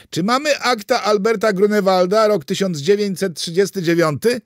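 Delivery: loud, high-pitched question; shouting. A narrator's reading. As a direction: call it out to a speakerphone from afar. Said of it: "krzyczał z nadelektrycznego czajnika". (głośne pytanie wołane do telefonu)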